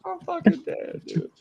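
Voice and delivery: high, helpless laughter through words; cracking up. Real, not acted. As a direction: laugh so hard you can barely talk. Cracking up at joke.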